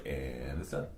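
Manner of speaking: very soft, low murmur; hushed, low, settled; quiet finality. Retold or imitated. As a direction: imitate a private decision, voice dropped right down. Painter quietly deciding it is finished.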